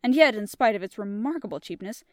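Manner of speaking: measured audiobook reading with character voices; storybook reading voice; story narration. A narrator's reading. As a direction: tell the tale steadily to listeners. Reads the story aloud.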